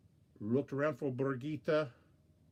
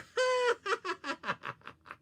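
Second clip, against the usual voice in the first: squeaky high-pitched cackle; high character laugh; high giggle. Acted. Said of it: "just laughing and laughing and laughing".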